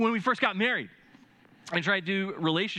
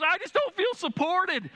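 wife's complaint; 13 semitones higher than usual. Retold or imitated. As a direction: play the hurt wife in a raised voice. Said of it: "She goes".

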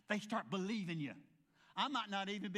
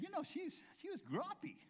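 put-on higher, softer old lady aside; a hint of a gossiping older lady, higher and softer; mother-in-law's voice; 10 semitones higher, 5 dB quieter than usual. Mother-in-law whispering about the waitress, higher and softer. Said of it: "my mother-in-law said".

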